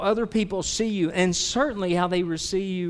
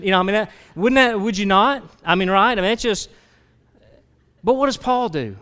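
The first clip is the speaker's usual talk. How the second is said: louder and higher, building hard; rising preacher shout; 5 semitones higher, 8 dB louder than usual. Acted. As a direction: push it up to a preaching peak.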